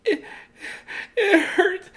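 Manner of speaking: very high squeaky whimper; crying bird. Acted. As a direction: whimper it tearfully. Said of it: "Bird kept crying".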